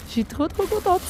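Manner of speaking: high, gushing delight. Real, not acted. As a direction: squeal with real joy. Happy about coffee grounds gift. (ravie, voix aiguë et enjouée)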